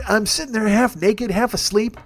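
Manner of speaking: ordinary talk, nothing marked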